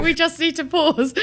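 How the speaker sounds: laughing. Real, not acted. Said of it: "I'm literally crying".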